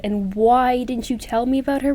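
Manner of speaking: ordinary talk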